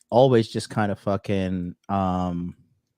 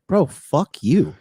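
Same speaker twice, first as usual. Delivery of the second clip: slow, higher, louder defiant retort; giving attitude; 8 semitones higher, 7 dB louder, much slower than usual. Retold or imitated. To armed airport guards, defiant slow retort. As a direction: drawl it out, full of contempt. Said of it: "'Cause I gave him an attitude".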